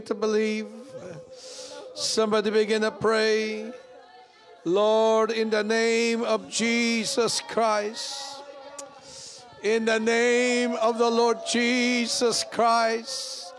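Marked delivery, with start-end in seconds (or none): 4.6-13.5 slow, drawn-out praying; fervent prayer. Real, not acted. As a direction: stretch each word in prayer.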